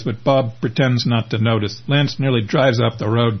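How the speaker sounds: ordinary delivery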